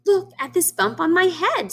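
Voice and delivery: high hen voice; lightly voiced worried hen. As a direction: show the bump in a high squeak. Hen voice complains.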